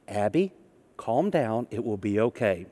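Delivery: put-on mother calming her child in the store; calm soothing mom. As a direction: soothe a screaming toddler gently.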